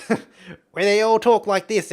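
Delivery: exaggerated cartoon Aussie, high, loud and drawn out. Shown as a demonstration. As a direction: stretch it into a ridiculous Australian accent. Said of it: "the stereotypes of Australians".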